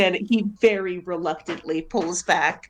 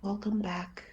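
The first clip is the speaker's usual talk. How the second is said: tender welcome; 2 semitones higher, 8 dB quieter than usual. Acted.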